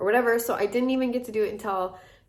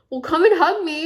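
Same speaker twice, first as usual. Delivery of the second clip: higher and louder, overwhelmed call for a hug; emotional plea; 8 semitones higher, 8 dB louder than usual. Retold or imitated. Stunned girlfriend begging her partner for a hug, voice up high and loud. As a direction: blurt it out, overcome.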